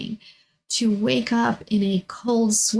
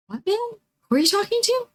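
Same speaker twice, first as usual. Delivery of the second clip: startled mom; 13 semitones higher, much faster than usual. Retold or imitated.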